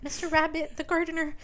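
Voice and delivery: high-pitched mimicry of her; imitating the girl. Host mocks the girl with two brain cells, high-voiced imitation. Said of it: "she's always like".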